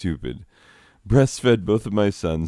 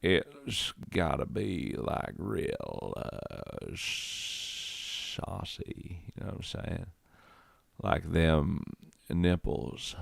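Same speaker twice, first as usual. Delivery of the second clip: mock-sultry murmur, dropping almost to a whisper; saucy voice; 3 semitones lower, 10 dB quieter than usual. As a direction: purr it softly like a hammed-up seduction. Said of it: "I'm not doing that voice".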